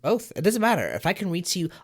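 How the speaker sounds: ordinary talk, nothing marked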